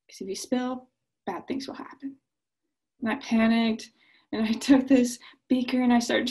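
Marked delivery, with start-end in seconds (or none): 0.1-2.2 softer, lowered warning; hint of a mock-sinister dad; ominous hush. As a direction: spook the kid with a quiet threat. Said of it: "He said".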